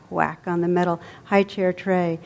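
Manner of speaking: plain reading voice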